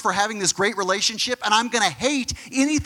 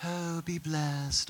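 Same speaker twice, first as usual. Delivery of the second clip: slow, much softer, dreamy blessing; mock serene Jesus; 5 semitones lower, 10 dB quieter, much slower than usual. Preacher mocking the soft always-gentle Jesus picture, slow and hushed. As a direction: float it out like a sleepy saint handing out blessings. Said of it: "Jesus is just like".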